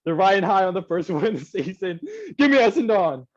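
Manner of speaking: hyped up. Acted. Joking after name mockery.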